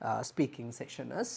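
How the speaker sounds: ordinary talk, nothing marked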